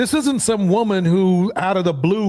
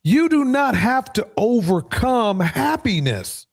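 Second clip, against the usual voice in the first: higher-pitched, hammering home DO NOT; emphatic restatement; 6 semitones higher than usual. Real, not acted. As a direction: make it clear, stressing DO NOT. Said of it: "Let me just make that clear".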